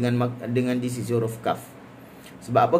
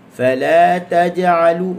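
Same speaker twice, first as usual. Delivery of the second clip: slow, louder "fala taj'alu" leaning on la; gentle pressure on lam; 6 semitones higher, 9 dB louder, much slower than usual. Shown as a demonstration. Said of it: "Kita gentle pressurekan sedikit di huruf lam". ("fala taj'alu" dengan tekanan sedikit pada lam)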